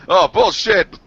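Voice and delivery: high, loud mock outburst; retold yell. Gamer re-enacting his yell heard by the house, mock yelling. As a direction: yell it out like a startled player. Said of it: "or me yell".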